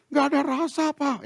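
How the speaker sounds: high imitated complaint; mock plaintive wife voice; whining wife. Wife complains food is tasteless.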